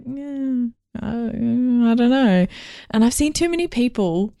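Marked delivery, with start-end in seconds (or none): 0.0-2.5 slow, unsure stammer; hesitant mumble. Retold or imitated. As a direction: stall with a vague excuse. Hesitant excuse quote.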